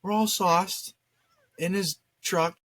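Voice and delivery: usual speaking voice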